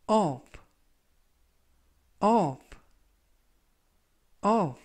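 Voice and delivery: of said clearly, repeated, UV; model pronunciation. Shown as a demonstration. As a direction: demonstrate of three times. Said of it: "라고 발음하시면 되겠고요". (of 발음 반복 시범)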